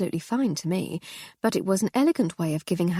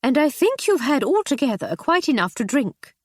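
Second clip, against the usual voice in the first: higher, louder stern rebuke; 7 semitones higher, 5 dB louder than usual. Acted. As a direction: scold the drunk husband firmly. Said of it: "she replied sternly".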